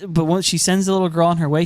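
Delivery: normal talking voice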